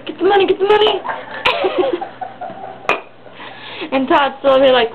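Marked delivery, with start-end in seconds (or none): 0.1-1.0 urgent demand. Retold or imitated. Bob urging in retold scene. 1.1-3.0 high breathless laugh. Real, not acted. Speaker laughing at own story.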